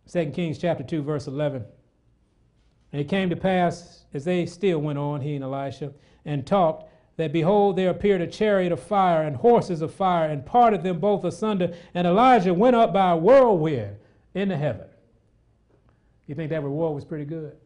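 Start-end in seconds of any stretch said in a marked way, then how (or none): 2.9-14.9 preacher reading scripture aloud; fervent scripture reading. Acted. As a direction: proclaim the passage to the hall. Scripture read with fervour.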